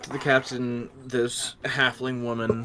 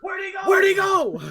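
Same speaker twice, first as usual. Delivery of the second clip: high, loud baffled yell; surprised shout; 5 dB louder than usual. Acted. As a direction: call out looking around. Players shout after vanished captain.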